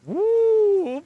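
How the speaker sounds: high, loud whoop of joy; excited whoop. Real, not acted. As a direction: cheer at the big loot. Big loot found.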